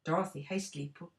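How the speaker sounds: plain reading voice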